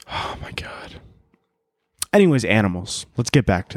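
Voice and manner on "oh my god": hushed, voiceless aside to himself; exasperated whisper. Real, not acted. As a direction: whisper it, worn out by the rant. Exhausted aside after ranting.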